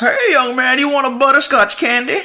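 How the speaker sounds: put-on very high-pitched creepy old man voice; high, held-up voice of a creepy old man offering sweets.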